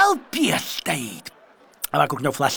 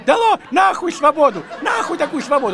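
shouting; 8 semitones higher than usual. Retold or imitated. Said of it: "Я говорю".

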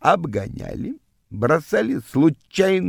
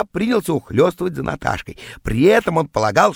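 usual speaking voice

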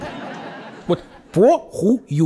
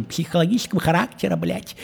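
normal talking voice

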